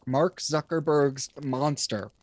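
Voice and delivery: ordinary talk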